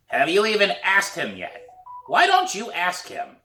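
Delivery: put-on high, pushing old lady voice; hoarse old woman prodding. A narrator's reading. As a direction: dare her to go ask. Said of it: "It's a hoarse voice".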